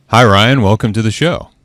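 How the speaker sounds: loud excited greeting. Real, not acted. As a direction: greet the guest with a loud burst. Host greets guest on air.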